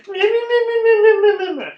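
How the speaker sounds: high, loud meh-meh yapping; mocking the teen girls' endless talk; mocking blather. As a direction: mimic pointless chatter as meaningless noise. Mocking pointless girl chatter.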